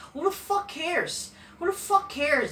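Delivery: high, drawn-out exasperated scorn; real exasperation, slow and high; scornful dismissal. Real, not acted. Scornful rant dismissing the wrestler's feuds.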